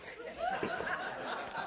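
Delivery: a sudden high-pitched laugh breaking out; high burst of laughter. Real, not acted. Minister laughing at her own joke about Kevin, high laugh.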